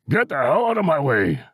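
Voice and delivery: high, strained character shout; old man's shout. A narrator's reading. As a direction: order someone aside as a cranky old man. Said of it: "he shouted".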